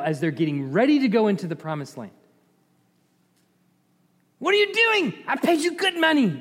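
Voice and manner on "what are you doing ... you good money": much higher, indignant king's voice; high, exasperated royal complaint; outraged king. Acted. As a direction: scold the hired prophet like an outraged king. The king berates Balaam again.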